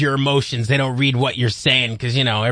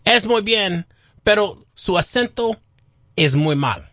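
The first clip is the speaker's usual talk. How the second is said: mock Spanish; 6 semitones higher than usual. Shown as a demonstration. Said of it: "your accent is horrible".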